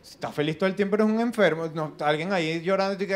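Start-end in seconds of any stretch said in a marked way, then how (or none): none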